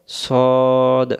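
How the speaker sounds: "সদ" with one long rounded "o"; rounded-mouth pronunciation. Shown as a demonstration. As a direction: round the mouth and hold "সদ" as a drawn-out "sawd". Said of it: "মুখটা গোল হবে". ("সদ" মুখ গোল করে লম্বা টেনে)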